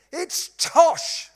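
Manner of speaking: high, loud outburst; emphatic dismissal. Real, not acted. Preacher debunking the graduates' slogan, loud dismissal. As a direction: punch out the rebuttal.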